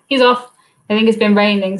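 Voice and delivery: usual speaking voice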